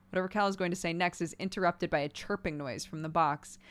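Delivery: usual speaking voice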